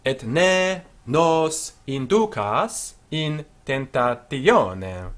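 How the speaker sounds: slow model phrase. Shown as a demonstration. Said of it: "teacher models full phrase slowly".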